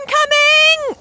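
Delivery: a loud, high yell of alarm; crying out to the others before impact, a loud high yell; shouted warning. Acted. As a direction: yell it as danger drops in. Warning as someone crashes down.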